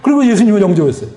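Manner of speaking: ordinary talk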